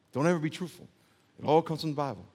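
normal talking voice

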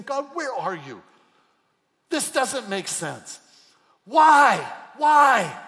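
loud, high cry of why; crying out to God in pain; anguished cry. Retold or imitated. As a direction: build to a desperate shout. Voicing a sufferer's cry.

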